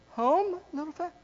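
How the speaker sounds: very high, tiny soft voice; practising the line. Acted. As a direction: squeak it out softly, rising. Trying out ways to say it.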